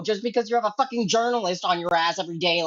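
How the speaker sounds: usual speaking voice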